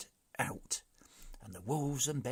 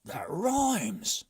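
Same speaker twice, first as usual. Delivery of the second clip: loud, higher pleased remark; admiring chief; 8 semitones higher, 8 dB louder than usual. A narrator's reading. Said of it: "observed the chief admiringly".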